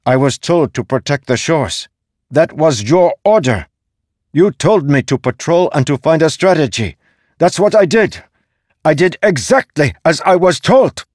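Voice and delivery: indignant, escalating outburst; growing anger. A narrator's reading. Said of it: "growing even more angry".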